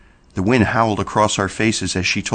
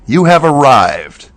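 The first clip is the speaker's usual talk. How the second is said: loud, slow, welcoming call; joyful shout; 5 semitones higher, 8 dB louder, slower than usual. A narrator's reading. Paul welcoming birthday boy Roy, loud and slow. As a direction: announce him like a guest of honour. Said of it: "Paul shouted".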